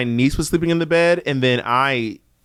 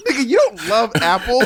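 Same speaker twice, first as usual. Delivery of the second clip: raised, higher, mad retort; retold anger; 5 semitones higher than usual. Host retelling his row with co-host over apples, mock mad. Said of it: "I got mad. I was like".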